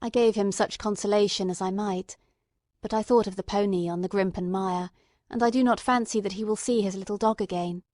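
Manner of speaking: plain reading voice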